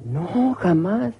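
high, very drawn-out denial; mock-innocent denial. Woman asked if she ever coveted, hammed-up denial. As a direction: stretch it high, hamming up her innocence. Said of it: "¿Usted ha codiciado algo".

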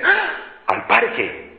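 mock-delighted kid asking back; lightly voiced thrilled little boy; excited child. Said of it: "Y como que le da vida".

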